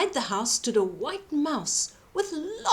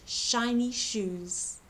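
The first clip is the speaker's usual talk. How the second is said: usual speaking voice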